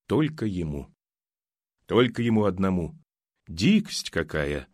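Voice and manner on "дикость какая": slow, low, hesitant remark; mother-in-law unsure, judging the neighbours; uncertain. Acted. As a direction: say it unsure of yourself. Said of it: "неуверенно произнесла Оксана".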